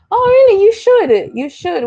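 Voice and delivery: high, loud burst of delight; real squeal of excitement; excited surprise. Real, not acted. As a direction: react with a loud, high-pitched thrill. Delighted reaction to viewer's comment.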